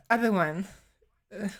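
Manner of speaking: ordinary talk, nothing marked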